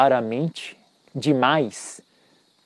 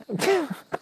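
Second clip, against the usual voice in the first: light high laugh; 6 dB quieter than usual. Real, not acted. Amused by own point.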